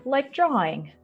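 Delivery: plain reading voice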